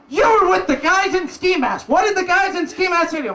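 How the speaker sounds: mock-stern border guard questioning; interrogating guard. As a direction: grill the tourist hard. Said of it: "It's like".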